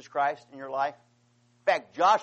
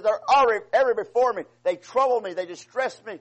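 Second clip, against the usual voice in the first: higher and louder, a sorrowful plea to God; remorseful cry for forgiveness, raised high; pleading prayer; 4 semitones higher, 6 dB louder than usual. Retold or imitated. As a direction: cry out to God in repentance, pitched up and loud. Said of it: "He says".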